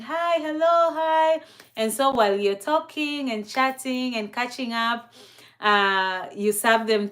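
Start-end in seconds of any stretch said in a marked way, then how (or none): 0.0-1.4 cheery greeting. Retold or imitated. Villager visitor greeting at door.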